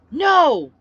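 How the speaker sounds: high, loud stopping bellow. Acted. She bellows from her pickup to stop the mob. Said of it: "I bellowed".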